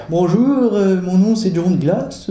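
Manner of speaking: put-on léger accent français de France, plus aigu; présente-toi en prédicateur avec un accent français; petit accent français. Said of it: "avec un petit accent français". (petit accent français de prédicateur)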